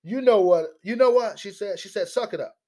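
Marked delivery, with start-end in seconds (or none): 0.0-1.4 higher, louder sassy imitation; sassy mock. Commentator imitates the congresswoman's sass, higher. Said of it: "That's how she said it". 2.1-2.6 higher, dismissive imitation; dismissive mock. Commentator imitates the congresswoman brushing off critics, higher. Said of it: "That's how she said it".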